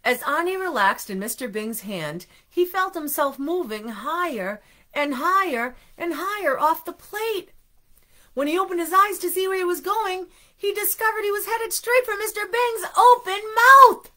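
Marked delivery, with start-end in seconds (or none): 2.5-14.1 storyteller winding up to a big, high, loud reveal; building alarm. A narrator's reading. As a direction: rise higher and louder toward the climax. Reader builds suspense to alarming climax.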